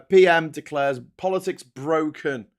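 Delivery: ordinary delivery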